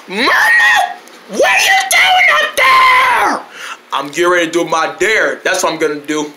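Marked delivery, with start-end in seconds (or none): 0.1-3.4 high, slow holler upward; shrill-pitched, stretched-out yell; alarmed call. Acted. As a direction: shout up to someone far above. Calling up to someone high.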